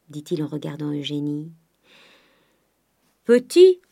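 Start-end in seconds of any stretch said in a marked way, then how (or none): 3.2-3.8 high, loud questioning exclamation; outraged, leaping-up question; incredulous echo. A narrator's reading. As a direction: throw the word back in disbelief. Grandet scoffs at the word.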